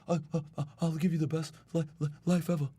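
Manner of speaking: mock stutter on every other word; half-hearted stammering impression of Elon Musk. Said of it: "stuttering all over the place".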